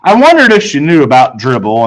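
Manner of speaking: usual speaking voice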